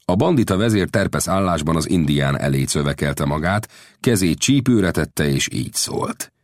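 ordinary delivery

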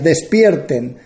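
ordinary talk, nothing marked

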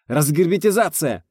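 loud, high urgent yell; barked alarm. A narrator's reading. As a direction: bark it out as an emergency warning. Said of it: "рявкнул почтарь". (рявкнуть во весь голос)

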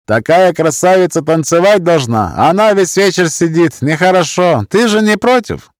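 put-on wheedling drunk in a Roma accent; sly Roma accent, insinuating. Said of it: "голос с цыганским акцентом".

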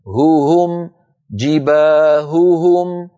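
slow "هو هم", then "جباههم" with both ه clear; drawn-out "جباههم", each ه separately breathed; two audible ه. Shown as a demonstration. Teacher repeating for listeners, exaggerated articulation. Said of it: "هل سمعتم الهاءين؟".

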